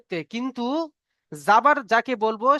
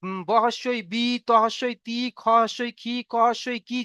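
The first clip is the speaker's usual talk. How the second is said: reciting the primer. Shown as a demonstration. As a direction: chant the syllables for the pupils. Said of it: "যেমন কি".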